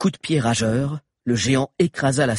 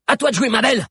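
high, loud encouragement; rousing call; 13 semitones higher, 6 dB louder, faster than usual. A narrator's reading. Mano cheering on Grove as pursuers close in, rousing call. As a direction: urge the horse into action. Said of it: "lança Mano".

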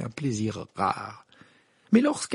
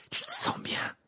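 worried friend; 4 dB quieter than usual. Acted. Ron kneels, terrified.

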